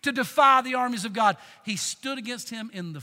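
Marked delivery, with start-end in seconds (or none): 0.0-1.4 defiant challenge. Retold or imitated. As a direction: throw the challenge at the giant. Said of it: "He said".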